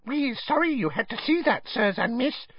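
very high, apologetic squeak; high-pitched servile little voice; squeaking elf. A narrator's reading. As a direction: apologise as a small, ashamed house elf. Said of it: "squeaked a nearby elf".

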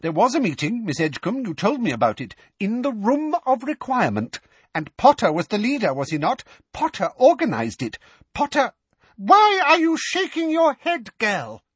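high, rising to loud fury; losing her temper. A narrator's reading.

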